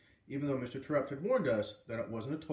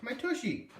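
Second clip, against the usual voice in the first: high squealing complaint; 10 semitones higher, slower than usual. A narrator's reading. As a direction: squeal about the sore spot. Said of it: "Lexi shrieked".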